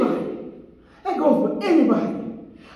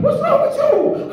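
higher, puzzled wife; wife's question; 12 semitones higher than usual. Retold or imitated. Wife asking what's wrong, lightly voiced higher. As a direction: pitch up as a baffled wife. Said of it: "My wife was like".

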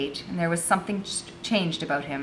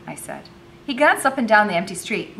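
ordinary delivery

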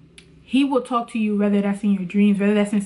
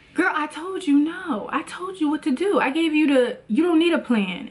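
God scolding; 6 semitones higher than usual. Retold or imitated. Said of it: "he just be like".

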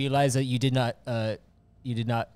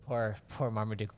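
ordinary talk, nothing marked

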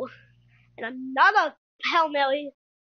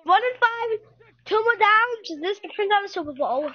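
higher, excited sportscaster calling the drive; play-by-play call; 4 semitones higher, 3 dB louder than usual. Acted. Gamer calling his own game for viewers, excited announcer voice.

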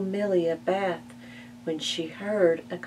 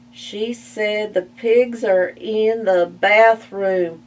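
slower, measured pig retelling; calm pig repeating; 4 semitones higher, 7 dB louder, slower than usual. Acted. Buster calmly repeating Ma's alarm to Spike, slow. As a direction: repeat it coolly, unlike Ma's panic.

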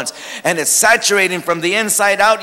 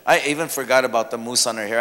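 normal talking voice

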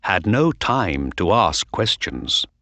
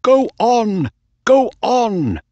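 cheering; 13 semitones higher, 4 dB louder than usual. A narrator's reading. Owner cheering his horse.

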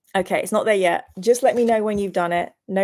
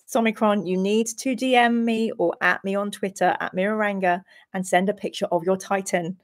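normal talking voice

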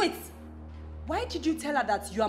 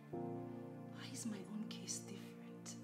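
soft, whispered, despairing musing; whispered lament; 7 semitones lower, 14 dB quieter than usual. Acted. Wife alone after the fight, whispering her sorrow. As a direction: confide your hurt under your breath.

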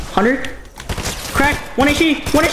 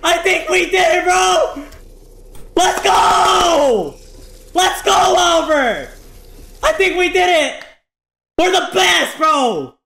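victory shouting; 8 semitones higher than usual. Real, not acted. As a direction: scream it like you just won. Winning the game, celebrating.

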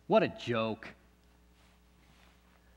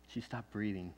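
much lower and softer, nearly gone; hushed grief; 6 semitones lower, 13 dB quieter than usual. Acted. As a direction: let it fall away to nothing. Mother's death revealed.

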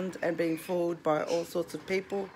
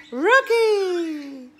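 loud high call with a laugh; 10 semitones higher, 8 dB louder than usual. Real, not acted. Owner calls her parrot Rocky, loud, high and laughing. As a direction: shout the bird's name to get him talking.

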